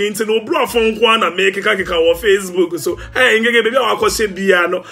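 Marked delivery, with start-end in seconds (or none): none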